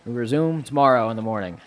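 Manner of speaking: a teacher's loud call to the room; addressing class. Acted. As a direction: dismiss the students with authority. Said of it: "turns around".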